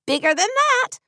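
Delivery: a high mock yell. A narrator's reading. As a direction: shout it up high, lightly. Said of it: "Katie yelled".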